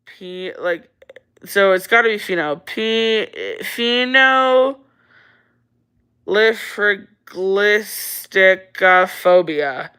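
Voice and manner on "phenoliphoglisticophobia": slow sounding out. Shown as a demonstration. Streamer slowly tries the viewer's hard word, sounding it out. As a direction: sound out "phenoliphoglisticophobia" very slowly, syllable by syllable. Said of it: "Give me the next thing I can't pronounce".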